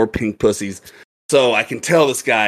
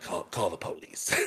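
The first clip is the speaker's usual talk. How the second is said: low, much softer instruction; retold low aside; 6 semitones lower, 14 dB quieter than usual. Other owner to bartender, low quiet aside. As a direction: pass the order on discreetly. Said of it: "and he's like".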